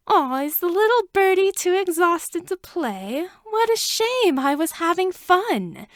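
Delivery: high, taunting, purring villain voice; purring sorceress. A narrator's reading. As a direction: purr it out like a smug witch. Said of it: "she purred".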